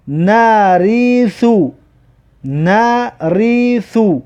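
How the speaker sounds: slow syllables, vowels held long; drawn-out model reading. Shown as a demonstration. Teacher models a word for learners, slow and drawn out. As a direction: stretch "نریثو" syllable by syllable.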